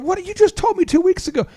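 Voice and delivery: mock disbelief. To the college buddy, faking shocked disbelief. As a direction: pitch jumps high on the protest. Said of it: "And I'm going".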